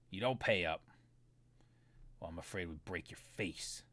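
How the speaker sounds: low, menacing thug voice, measured; a Trandoshan enforcer leans in on the debtor; Trandoshan thug threat. Acted. The GM as the Trandoshan threatening Jonathan, low and menacing.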